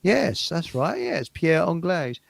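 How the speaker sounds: ordinary talk, nothing marked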